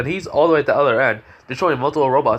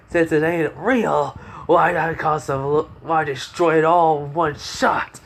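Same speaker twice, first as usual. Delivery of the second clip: cocky boast; 4 semitones higher, slower than usual. Acted. Character boasts before finishing blow.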